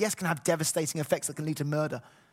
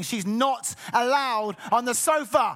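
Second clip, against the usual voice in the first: slow, louder firm telling; stern warning; 6 semitones higher, 5 dB louder, slower than usual. Real, not acted. Dad warning his kids via the sermon, stern. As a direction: lay down the law slowly. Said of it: "Kids, if you're listening".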